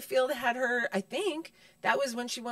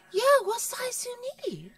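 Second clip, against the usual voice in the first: high, far-off voice as the man behind the counter; lightly voiced shopkeeper; shop clerk; 12 semitones higher than usual. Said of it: "the man behind the counter's like".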